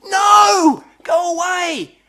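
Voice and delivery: loud shout of no, go away; shouted refusal. Acted. Engineer yelling away a component supplier, loud and fed up. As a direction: yell it off like a pest.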